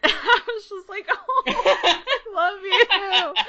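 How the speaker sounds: high-pitched, slow gush through laughter; giddy laughing. Retold or imitated. Fan answering her idol's greeting, giddy and laughing. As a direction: gush helplessly while laughing.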